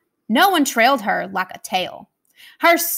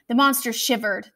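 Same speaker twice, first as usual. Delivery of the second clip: plain reading voice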